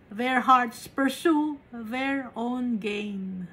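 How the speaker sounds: very slow, each word of "Their hearts pursue their own gain" weighted with stress on "pursue"; a preacher pressing the verse home, very slowly; slow emphatic repeat. Shown as a demonstration. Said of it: "repeated verse slowly for emphasis".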